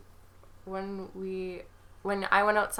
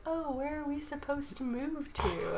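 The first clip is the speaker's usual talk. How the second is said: confused; 7 semitones higher, 3 dB quieter than usual. Retold or imitated. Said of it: "And they were like".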